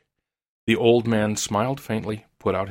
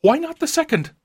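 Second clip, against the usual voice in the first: very high, loud, feverish question; 5 dB louder than usual. A narrator's reading. Old woman pushing for a second wish, feverish and high. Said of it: "said the old woman feverishly".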